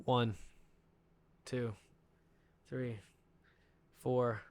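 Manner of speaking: counting out the silence slowly; slow dramatic count. Shown as a demonstration. Recounting the stunned pause, slow dramatic count. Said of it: "take a moment of silence".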